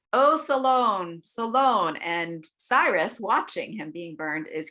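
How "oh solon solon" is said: higher, louder, slow anguished calling; slow desperate cry of a name. Retold or imitated. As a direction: call the name out from the pyre. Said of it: "he cries out".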